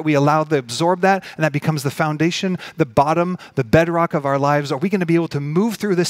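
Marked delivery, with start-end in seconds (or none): none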